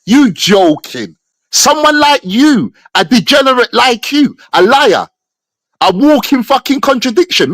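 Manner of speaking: ordinary delivery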